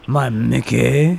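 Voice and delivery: slow French lilt, rising on "Mickey"; hammed-up French accent. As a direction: draw "Mickey" out in mock French. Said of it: "say that in a French accent".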